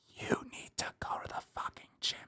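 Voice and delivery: voiceless, very soft aside; whisper. Acted. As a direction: whisper it as blunt advice. Mock-discreet blunt advice to Nicole.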